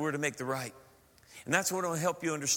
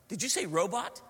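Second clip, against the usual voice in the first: put-on "robot" in own accent, rising in doubt; mildly incredulous question; puzzled query; 3 semitones higher than usual. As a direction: check that you heard "robot" right. Checks the odd word, own accent.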